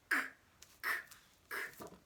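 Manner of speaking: sharp voiceless K bursts; short dry clicks of breath; coughing K. Shown as a demonstration. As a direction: cough out separate K sounds. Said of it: "is the coughing sound".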